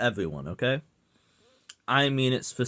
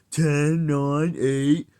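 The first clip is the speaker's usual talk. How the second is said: mock countdown; 4 semitones higher, 4 dB louder, much slower than usual. Mocking countdown at chat.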